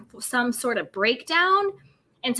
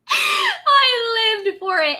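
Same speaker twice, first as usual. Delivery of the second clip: high, slow and squealing; thrilled squeal; 10 semitones higher, 3 dB louder, much slower than usual. Real, not acted. Host telling viewers how much she loved it, high squeal. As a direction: stretch it out in delight.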